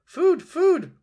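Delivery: very high, very loud squeal for food; peeping gosling. A narrator's reading. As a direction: shriek it like a starving baby bird. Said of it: "peeped the gosling".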